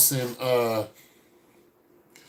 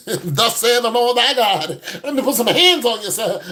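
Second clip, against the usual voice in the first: preachy church lady; 5 semitones higher, 4 dB louder than usual. Pretend. Church lady preaching at the man who said hello, high and loud. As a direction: lay hands on him, preacher style.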